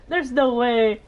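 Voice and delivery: ordinary delivery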